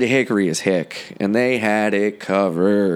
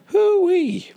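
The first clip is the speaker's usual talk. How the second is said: excited whoop. Real, not acted. Excited whoop before guest intro.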